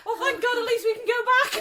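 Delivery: high, giggly retold relief; high-pitched quote bubbling into laughter; laughing relief. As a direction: act out relief while cracking up.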